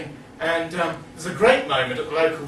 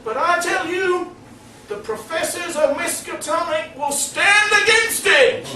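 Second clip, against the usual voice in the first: higher, mock-grand oratory; pompous professor; 9 semitones higher than usual. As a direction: preach to fellow academics, half laughing. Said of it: "the professor ends his tale by saying".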